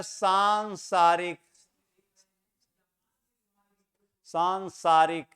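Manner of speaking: सांसारिक spelled out slowly with a long first aa; careful pronunciation. Shown as a demonstration. Teacher showing the right form to students, slow and deliberate. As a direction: sound out सांसारिक syllable by syllable. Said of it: "सांसारिक ऐसे होता है".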